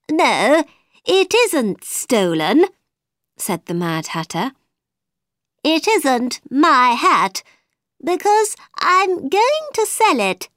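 high nervous hatter; Mad Hatter voice. A narrator's reading.

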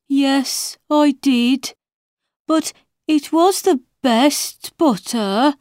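high, plaintive March Hare; sad. A narrator's reading. March Hare defending his butter to the Hatter, sadly. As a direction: plead it sorrowfully in a high voice. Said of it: "replied the March Hare sadly".

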